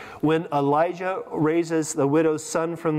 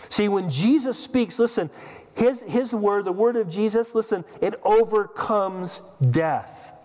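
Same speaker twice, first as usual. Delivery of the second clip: rising preacher fervor; 5 semitones higher, slower than usual. Real, not acted. Preacher urging the congregation to listen, fervent. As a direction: preach it with mounting conviction. Preaching climax on Jesus' word.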